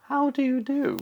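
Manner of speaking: very high, squeaky duck greeting; a prim little duck saying hello; polite duck. A narrator's reading. Duck greeting the highway rat, very high voice. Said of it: "stopped with a".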